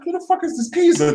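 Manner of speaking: thinking it at the cinema, high and startled; surprised. Retold or imitated. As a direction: pitch it way up in shock. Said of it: "It's like".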